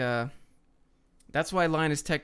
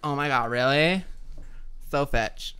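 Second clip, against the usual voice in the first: put-on Valley Girl accent. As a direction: imitate a gullible Valley Girl. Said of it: "The Valley Girl accent".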